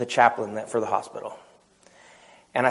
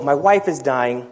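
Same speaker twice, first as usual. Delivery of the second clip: ordinary delivery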